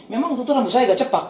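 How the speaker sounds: ordinary talk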